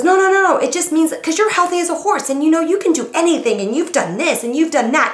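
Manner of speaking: high, loud frantic backpedal; panicked husband. Retold or imitated. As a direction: dig out of the hole fast. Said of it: "And he goes".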